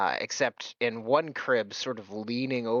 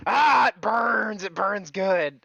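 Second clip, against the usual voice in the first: high, drawn-out wail of relished pain; hammed-up burning agony; mock burning pain; 8 semitones higher, 5 dB louder, slower than usual. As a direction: groan it slowly, loving the sting. Acting out snorting cookie powder.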